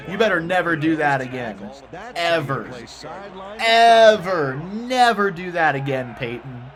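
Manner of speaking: playful telling-off, rising to a loud ever; mock scolding. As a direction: scold the quarterback like a coach. Scolding player on screen.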